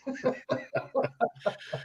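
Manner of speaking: high, breathless laugh at the punchline; breathy real laugh. Real, not acted. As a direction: laugh hard at the tree story.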